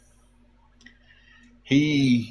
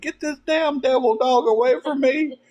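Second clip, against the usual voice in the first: panicked hollering. Retold or imitated. Derek chased by the neighbor's dog, panicked hollering. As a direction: shout it to get the dog away. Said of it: "he's hollering".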